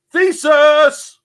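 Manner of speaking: high loud cry of delight; excited shout. Real, not acted. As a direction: call it out gleefully. Excited call out.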